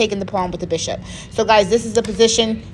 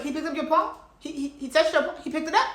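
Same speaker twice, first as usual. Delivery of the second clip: higher, softer, rising at the end; incredulous questioning; 5 semitones higher, 7 dB quieter, faster than usual. Retold or imitated. Coach double-checking the pawn story with student, incredulous. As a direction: imitate someone who can't believe what they heard. Coach re-enacted like LeBron's disbelief.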